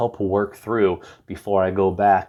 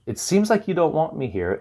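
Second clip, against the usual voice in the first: higher, tentative check-in; tentative, higher-pitched wondering aloud; 8 semitones higher than usual. Shown as a demonstration. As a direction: float the feeling gently at a raised pitch. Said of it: "That's external processing".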